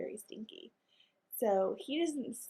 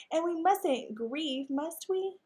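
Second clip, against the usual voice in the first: slow, higher, sweet lady dog voice on "mustn't grieve"; 7 semitones higher, 4 dB louder, slower than usual. Acted. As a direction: coax him sweetly, rising on "must we". Maggie voiced, then Henry echoes.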